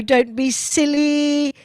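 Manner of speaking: usual speaking voice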